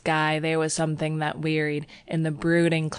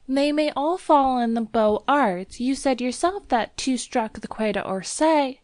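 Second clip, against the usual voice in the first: put-on high, insistent young woman; anxious protest; 8 semitones higher, 3 dB louder than usual. A narrator's reading. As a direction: argue back as the frightened girl. Girl argues anxiously with lover.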